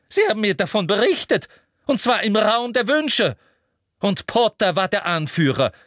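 very high, loud, ranting fury; furious. A narrator's reading. Umbridge berates Marietta for shaking her head, furious. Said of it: "sagte Umbridge wütend".

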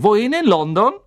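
loud, high-pitched conductor voice; Schaffner Stan. A narrator's reading. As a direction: call the question out high and loud. Stan fragt Harry nach dem Ziel. (lauter Schaffner mit hoher Stimme)

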